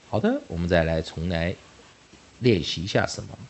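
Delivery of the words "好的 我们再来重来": ordinary talk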